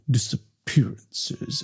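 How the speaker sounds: drawn-out, slow villain delivery; lightly imitated film villain; Lucius Malfoy. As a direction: stretch the word out slowly. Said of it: "is very much like Lucius Malfoy".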